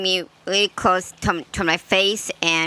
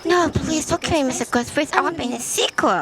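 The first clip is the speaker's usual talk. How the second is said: terrified horror victim; 7 semitones higher, faster than usual. Acted. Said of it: "Tatum Riley's death scene".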